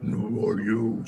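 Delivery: booming dragon voice calling out; booming arrogant alien overlord. Acted. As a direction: boom it down from above. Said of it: "you just hear this booming voice".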